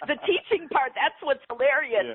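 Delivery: chuckling at the irony of it; laughing. Real, not acted. Laughs at her own irony.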